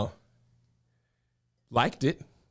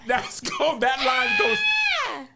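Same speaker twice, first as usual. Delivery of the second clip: excited; 6 semitones higher, slower than usual. Real, not acted. Stunned by the line.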